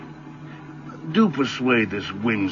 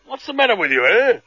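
indignant outburst; 10 semitones higher, 5 dB louder than usual. Acted. Man rebuffed, demands why.

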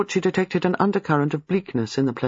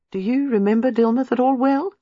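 artless; 9 semitones higher than usual. Acted. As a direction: ask as if nothing hangs on it. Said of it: "she asked artlessly".

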